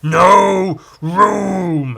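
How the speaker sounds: loud, high, long-drawn wail; hammed-up cry of the dead; corpse's wail. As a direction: stretch both words into a ghoulish howl. Voicing the corpses' refrain.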